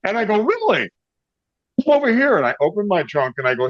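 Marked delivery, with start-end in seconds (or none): 0.4-2.4 high-pitched retold beckoning; eager invitation. Musician luring owner to car trunk, eager. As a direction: call him over eagerly, pitch up. Seizes the chance, calls owner over.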